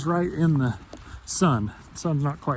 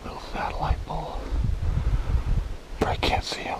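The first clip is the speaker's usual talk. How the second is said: whispering; 7 semitones higher than usual. Real, not acted. Whispering near elk while hunting.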